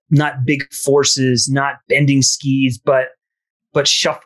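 normal talking voice

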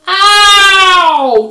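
very loud, high drawn-out "ow" howl; long high yell of pain; pained growl. A narrator's reading. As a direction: howl it out in agony. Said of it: "he growled".

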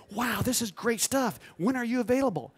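retold amazement. Agency staff admire a newcomer's portfolio, amazed. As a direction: imitate a wowed onlooker.

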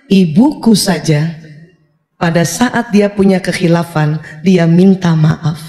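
slow and deliberate; child's slow reflection. Acted. Child learning from mother's apology, slow deliberate inner voice. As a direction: draw the words out thoughtfully.

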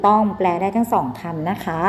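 ordinary talk, nothing marked

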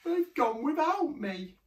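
high, slow lament; high, drawn-out woe; plaintive cry. A narrator's reading. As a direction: wail it like an abandoned youngster. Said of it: "And he cried".

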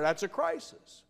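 ordinary talk, nothing marked

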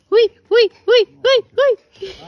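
playful squealing chase; being chased. Real, not acted. Playfully chasing a child.

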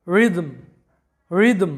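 রিদম said "ri-dom", with no h sound; রিদম as the English "rhythm" with the h silent. Shown as a demonstration. Teacher repeats রিদম to show the silent h. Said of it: "এইচটার কিন্তু কোনো উচ্চারণ হয় নাই".

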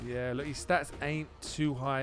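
ordinary talk, nothing marked

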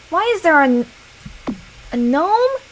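loud indignant cry; outraged. Real, not acted. Gamer yelling at a gnome in her picture, outraged. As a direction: yelp at the ruined photo.

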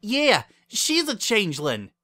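loud, high, emphatic charge; indignant accusation. A narrator's reading. Left Bon Bon accusing her double, indignant.

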